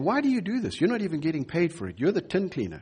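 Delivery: lightly voiced workers, baffled; puzzled workers. Workers question the tin cleaner.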